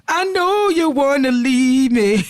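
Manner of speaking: mock the leaving husband, slow and high; mocking sing-song taunt. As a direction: drag it out to mock him. Voicing the wife mocking husband.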